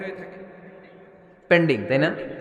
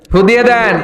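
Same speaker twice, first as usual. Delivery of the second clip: higher, louder imitated demand; mock loud plea; 5 semitones higher, 5 dB louder than usual. Parent asking the cleric to blow a blessing, loud mock plea. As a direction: call out the request, imitating an eager parent.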